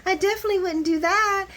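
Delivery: high, put-on innocent voice; sweet fake denial, high; mock innocent Lois. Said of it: "Lois is like".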